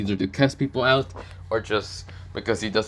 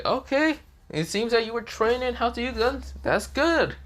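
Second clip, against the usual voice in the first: high teacher voice; 12 semitones higher than usual. Acted.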